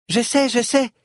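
high, impatient little voice; high-pitched childish impatience. A narrator's reading. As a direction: brush off advice like an impatient child. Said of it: "dit Phalom impatiente".

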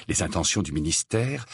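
ordinary delivery, nothing marked

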